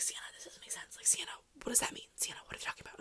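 hushed, breathless whisper in a theater; urgent whispered confusion. Retold or imitated. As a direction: whisper it so the actors won't hear. Classmates whispering during the play.

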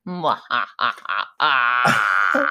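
slow, low supervillain cackle; evil laugh. Pretend. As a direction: cackle like a cartoon villain. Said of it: "Until you did that laugh".

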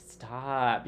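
ordinary delivery

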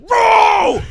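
very high, loud drawn-out yell; hyped shout. Retold or imitated. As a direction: scream it like a thrilled fan. Acting out his hyped reaction.

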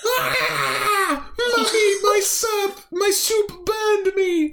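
put-on very high wailing child's cry; child crying to mommy about soup; crying alien child. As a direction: wail like a burned little kid. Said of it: "she goes".

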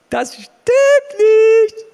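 high, loud and very slow; slow shout. Real, not acted. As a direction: drag it out at full shout. Final repeat, shouted slowly.

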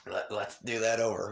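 ordinary delivery

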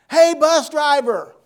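high, loud call across a store; shouted greeting from a distance. Retold or imitated. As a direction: hail him from across the aisles, slowly. Calling out across Walmart.

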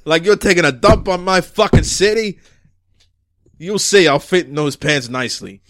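heavy, emphatic chief's tirade; ranting police chief. Acted. Chief ranting at detective over a missed shot, exasperated.

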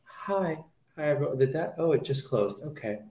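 soft, timid hi everyone; playing a shy youth pastor; hushed, meek greeting as awkward Toby. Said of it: "Toby, youth pastor".

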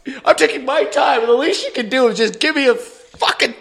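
high, whiny, loud tantrum; mock mad kid. Eleven-year-old rants to dad about the job, high mock tantrum. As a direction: rant like a worked-up child. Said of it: "I'm mad, okay?".

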